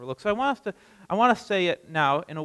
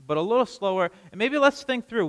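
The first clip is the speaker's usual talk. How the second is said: ordinary talk, nothing marked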